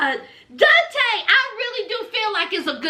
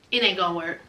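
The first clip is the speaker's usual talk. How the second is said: much lower, softer, heavy; gloomy resignation; 12 semitones lower, 6 dB quieter, much faster than usual. Retold or imitated. Heartbroken ex giving up on Nicole, low and soft. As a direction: let it sink in low and soft.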